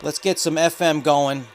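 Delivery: ordinary talk, nothing marked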